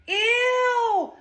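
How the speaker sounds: high, squealed ew of disgust; a snob grossed out by an old phone. Acted. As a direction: recoil at her like a snob. Mean girl disgust.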